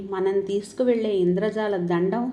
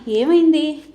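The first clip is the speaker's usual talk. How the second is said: higher and louder, alarmed "ఏమైంది?"; anxious question; 6 semitones higher, 8 dB louder, slower than usual. A narrator's reading. Dharani anxiously asks the crying Geetha, alarmed. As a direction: ask "ఏమైంది?" in sudden worry. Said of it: "ధరణి కంగారుగా".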